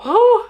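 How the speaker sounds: loud squeaky exclamation; high cartoon yelp. Shown as a demonstration. Cartoon yelp while mocking the negative thought. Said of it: "Para empezar a hablar así".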